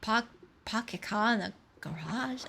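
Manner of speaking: put-on Park your cah in the garage in a light Boston accent, r dropped; a hint of a Boston accent, dropping the r. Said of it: "Say it like a Bostonian?".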